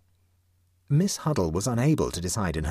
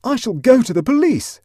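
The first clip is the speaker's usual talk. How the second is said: very high, much louder shout; 11 dB louder than usual. A narrator's reading. Huddle threatening Clovis, a very high loud shout. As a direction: burst out with sudden energy. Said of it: "shouted Huddle with sudden energy".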